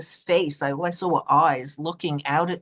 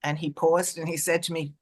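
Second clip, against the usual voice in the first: usual speaking voice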